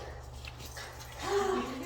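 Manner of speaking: high, drawn-out playful gasp; teasing intake of breath; excited gasp. Real, not acted. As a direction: gasp to wind up a dog. Hyping dog up in play.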